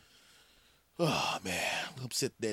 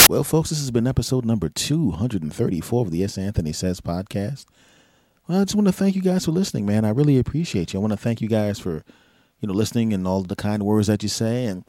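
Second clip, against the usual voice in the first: much louder, pumped-up radio sign-off; hyped outro; 12 dB louder than usual. Acted. Host thanking listeners at episode end, loud and hyped.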